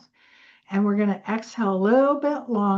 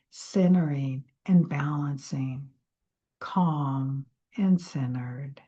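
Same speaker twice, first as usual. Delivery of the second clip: guided meditation; 3 semitones lower than usual. Acted. Guiding listeners to center, fading to a whisper. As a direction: ease them down into calm. Leads breathing exercise.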